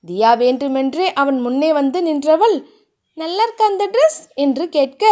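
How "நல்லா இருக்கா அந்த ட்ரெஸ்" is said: put-on higher, coy girlish question. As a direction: fish for a compliment sweetly. Narrator voices Thiya.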